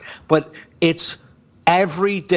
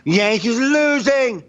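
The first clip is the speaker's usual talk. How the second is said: slow, higher gloating announcement; mock gloating report; gloating niece; 10 semitones higher, slower than usual. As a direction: rub the bad news in, drawn out. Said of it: "She'll be like".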